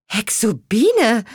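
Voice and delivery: slow, high surprised cry of the name; young witch surprised to see her friend; astonished call. A narrator's reading. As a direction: call out in amazement. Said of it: "rief Petronella erstaunt".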